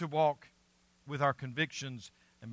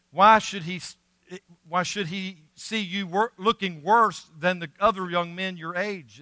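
afraid official; 4 semitones higher, 7 dB louder than usual. Retold or imitated. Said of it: "the official told Daniel".

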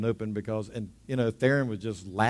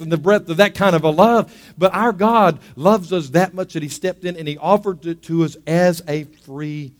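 fervent preaching; 5 semitones higher, 10 dB louder than usual. Acted. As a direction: drive the point home to the congregation. Preaching climax, congregation urged.

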